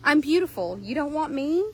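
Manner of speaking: high, pleading horse voice; disappointed horse. Acted. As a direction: plead as a rejected pet, voice lifted. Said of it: "He's so disappointed".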